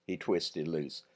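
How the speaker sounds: ordinary delivery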